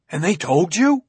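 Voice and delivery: sharp, vehement question. A narrator's reading. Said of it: "startled at his father's vehemence".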